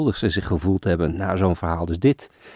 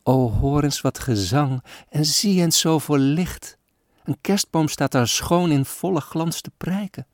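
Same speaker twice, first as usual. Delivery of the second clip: put-on higher, wondering little girl; excited child; 5 semitones higher than usual. Sick girl shows father the tree, higher and wistful. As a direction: marvel at the lights like a poor child. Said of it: "roept zij uit".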